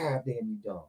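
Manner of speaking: very soft, low retold aside; hushed, exasperated mutter; muttered disbelief. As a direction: drop right down, as if to yourself. Retold muttering at stray dog.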